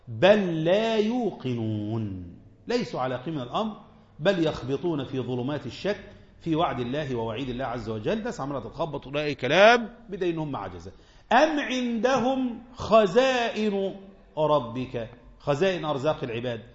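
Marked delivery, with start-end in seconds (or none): 0.1-2.3 slow, drawn-out recited verse; Quran recitation. Acted. Teacher reciting a verse to students, slow chant. 11.3-15.1 slow, higher drawn-out recitation; Quran recitation. Acted. Teacher reciting a verse to students, slow chant.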